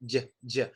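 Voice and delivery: soft, low "j" as a bare consonant; isolated J sound. Shown as a demonstration. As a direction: sound out "j" as "juh", twice. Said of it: "the J sound is basic".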